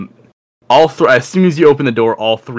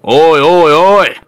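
loud, high barking call; shouted oi; 6 semitones higher, 7 dB louder, slower than usual. Acted. Barracks senior blocking the newcomer, loud high shout. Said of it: "puts their arm in the way and just says".

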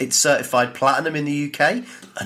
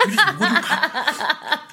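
laughing; 3 dB louder, much slower than usual. Real, not acted. Laughing while quoting Holmes.

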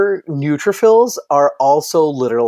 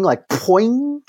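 high bouncy sound effect; springy poing; 8 semitones higher than usual. Retold or imitated. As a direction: make the trap spring. Imitating a trap springing.

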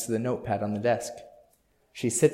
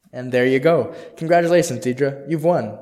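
higher, louder hammed-up winner announcement; loud congratulations; 3 semitones higher, 8 dB louder than usual. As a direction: shout it like a game-show host.

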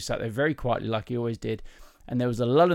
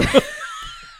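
high-pitched burst of laughter; helpless, shrieking laugh; real laughter; 3 dB louder than usual. Real, not acted. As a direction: crack up at your own punchline. Cracks up at own punchline.

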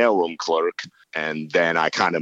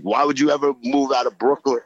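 higher-pitched retold yell at parents; mock complaint yell; 7 semitones higher than usual. Son yelling at parents over leaving Brooklyn, mock complaint. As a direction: plead indignantly, imitating a younger self. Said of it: "I would always yell at them".

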